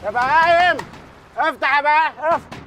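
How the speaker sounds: bang on the door shouting insults; angry calling out. Acted. Man shouting at people inside to open up. Yelling to be let in.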